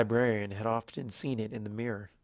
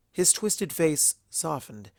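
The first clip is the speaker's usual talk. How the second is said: plain reading voice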